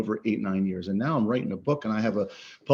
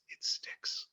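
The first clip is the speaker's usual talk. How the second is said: breathy whispered aside; 16 dB quieter than usual. Real, not acted. Speaker telling the audience how ideas stick, whispering. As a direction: drop to a whisper to land the point.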